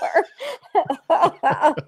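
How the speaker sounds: high, amused burst of laughter; real, drawn-out laugh; hearty laugh. Real, not acted. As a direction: laugh helplessly at a funny story. Host laughs at the story.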